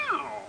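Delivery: high, short waking meow; imitated cat waking with a meow. A narrator's reading. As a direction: let out a high cat's meow. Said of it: "el gato despertó".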